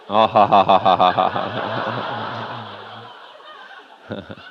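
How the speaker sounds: a run of real open laughs, ha ha ha; real laughter. Real, not acted. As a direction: laugh it out freely at the memory. Laughing at own anger memory.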